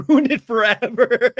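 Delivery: breaking into real high laughter; cracking up. Real, not acted. Host quoting a dramatic line, breaking into real laughter. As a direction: lose the quote into helpless laughs.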